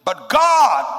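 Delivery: but God rises loud and high; emphatic shout. Acted. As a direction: proclaim it, voice leaping up. Preacher's climactic declaration.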